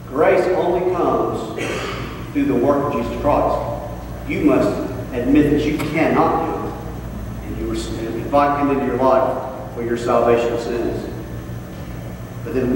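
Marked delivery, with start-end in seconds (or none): none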